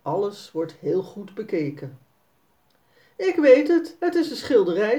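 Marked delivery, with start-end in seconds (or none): 3.2-5.0 put-on higher and louder, a boy sure he has it; excited little boy guessing; eager guess. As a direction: blurt out the answer excitedly.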